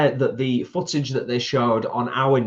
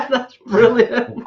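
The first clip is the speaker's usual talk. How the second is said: laughing; 8 dB louder, much slower than usual. Real, not acted. Laughing at the goose joke.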